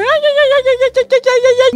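high squeaky little rat cries; squeaking rat voice. Retold or imitated. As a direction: squeak like a tiny screaming rat. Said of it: "gritando enquanto ele anda".

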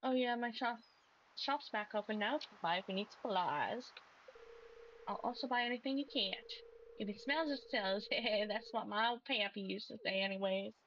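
put-on a stronger American country accent with a chuckle; folksy shopkeeper repeating his pappy's saying; thicker country accent. Fisherman reopens his shop for the farmer, in a thick country accent. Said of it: "This gets more and more country by the moment".

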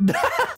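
shrill burst of giggling; can't stop laughing; real squeaky laugh; high squeal laugh. Real, not acted. Laughing at the sleeping horse.

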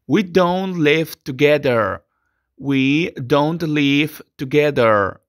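slow, clear sentence for repetition; slow English teacher model. Shown as a demonstration. Teacher dictates the model sentence to students. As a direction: articulate each word for the class.

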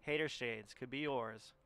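infomercial voice. Pretend. Hater Shades mock infomercial.

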